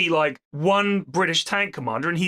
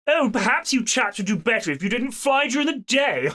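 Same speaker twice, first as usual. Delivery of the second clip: mock posh officer, higher pitched, ending in a haughty laugh; haughty British officer; 5 semitones higher than usual. British officer needling American pilots in a pub, mock haughty posh voice. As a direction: sneer like a caricature toff officer. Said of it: "this absolute prick of a British officer".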